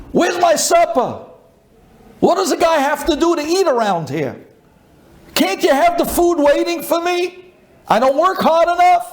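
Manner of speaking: high-pitched retold tirade; angry husband. Husband demands supper from his wife, angrily. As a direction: act out an indignant, yelling complaint. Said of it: "He's already angry at the world".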